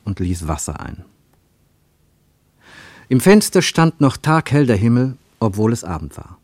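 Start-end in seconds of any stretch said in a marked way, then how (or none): none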